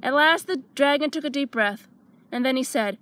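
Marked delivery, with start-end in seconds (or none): none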